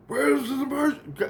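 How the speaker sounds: lightly voiced caller asking what's wrong; imitating Christ. Christ asking what the emergency is, high mock voice. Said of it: "Christ goes".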